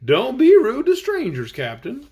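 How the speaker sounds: high, loud scolding Scarecrow; a lightly voiced Scarecrow scolding. A narrator's reading.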